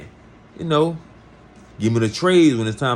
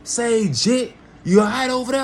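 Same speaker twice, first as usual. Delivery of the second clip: yelling across the cells; 10 semitones higher than usual. Retold or imitated. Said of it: "everybody yelling at me".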